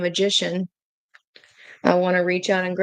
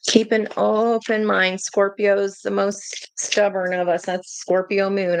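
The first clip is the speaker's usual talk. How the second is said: ordinary talk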